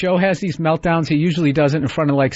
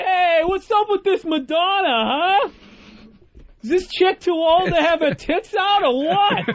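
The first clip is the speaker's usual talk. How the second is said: much higher put-on jeering voice, slowed; high mocking loudmouth voice; obnoxious heckler; 11 semitones higher than usual. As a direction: sneer at the rich crowd in a high cartoon voice. Mocking a rich party crowd.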